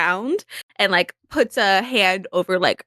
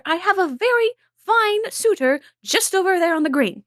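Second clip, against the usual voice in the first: higher, preening lady voice; proud Izzy; 8 semitones higher than usual. Acted. Izzy to her mother, bragging about her suitor, high preening voice. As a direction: boast sweetly to Mother.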